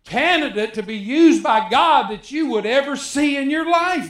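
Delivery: high, pleading little-man voice; a hint of a whiny Gideon putting himself down; Gideon's self-doubt. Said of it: "That's what he would say".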